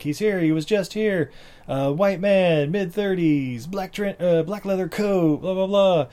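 hammed-up police order; urgent detective. Acts detective ordering a manhunt.

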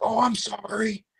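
imitating the other hunter, high, slow and soft; sheepish apology. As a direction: mimic a caught guy's meek sorry.

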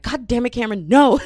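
loud, rising outburst ending in a yell; exasperated shout. Retold or imitated. Teller yells at Cameron over the flirty emoji idea, loud. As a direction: shout her friend's name in protest, then a final no. Protesting friend's risky idea.

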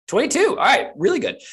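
high, loud burst of approval; excited reaction. Real, not acted. Game master hearing a twenty-two roll, loudly excited. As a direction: exclaim it way up high.

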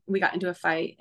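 ordinary delivery

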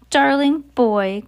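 high, drawn-out sugary coo; syrupy coo. A narrator's reading. Grand High Witch luring Bruno to the platform, syrupy coo. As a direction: lure him in with slow, syrupy sweetness.

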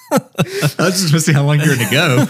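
talking through laughter; laughing. Real, not acted. Laughing at co-host's humming.

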